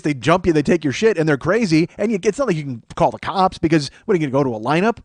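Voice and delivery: ordinary talk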